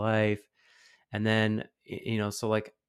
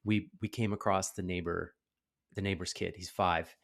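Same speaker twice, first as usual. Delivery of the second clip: ordinary talk, nothing marked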